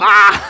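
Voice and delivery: loud high shrieking cackle; long, shrieked fake laugh; hammed-up crazed laughter. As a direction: burst into a mock-hysterical laughing fit. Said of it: "Laughing yourself".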